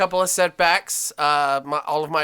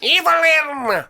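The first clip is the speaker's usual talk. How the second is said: dramatic villain name call; 10 semitones higher, 3 dB louder than usual. Acted. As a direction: announce the name like a cartoon villain.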